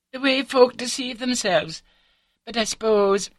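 high, chatty wife's voice; artless confessing wife. A narrator's reading. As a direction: confess it breezily, pitched well up.

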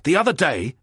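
loud, high eager telling; excited. Acted. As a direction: rush on, all worked up. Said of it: "the excited speaker went on".

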